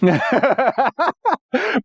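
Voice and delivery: real high-pitched laugh. Real, not acted. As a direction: burst out laughing at a joke. Laughs at co-host's joke.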